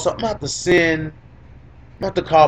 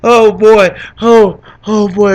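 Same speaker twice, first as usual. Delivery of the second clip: choked up; 4 semitones higher, 5 dB louder, slower than usual. Real, not acted. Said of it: "I'm getting choked up".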